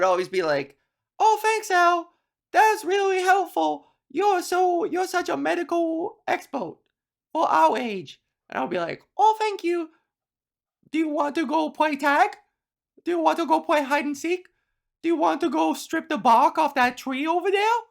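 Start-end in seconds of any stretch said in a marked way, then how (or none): 1.2-8.2 very high put-on child's voice; squeaky-high kid gushing thanks; grateful little kid. Voices grateful playground kids. 9.2-17.8 high put-on child's voice; young child eager to play; little kid. Plays young self inviting friends.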